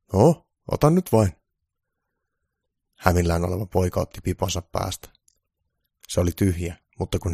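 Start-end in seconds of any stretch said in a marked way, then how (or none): 0.1-1.4 hidas, korkeampi ja kovempi kehotus; pukin kehotus. A narrator's reading. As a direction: houkutteleva pukin ääni, hitaasti. Pukki kehottaa poikaa. (hidas, kova pukin kehotus)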